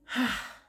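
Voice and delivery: sharp, high exhale; real sigh before hard news; heavy sigh. Real, not acted. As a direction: let out a big breath to gather yourself. Gathering herself before hard news.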